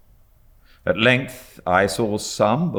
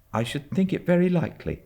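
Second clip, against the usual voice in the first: put-on higher, quick, nervous boy; timid boy's voice; 13 semitones higher, faster than usual. A narrator's reading. Boy agreeing with the driver, quick and nervous. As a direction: rush it out like a worried child.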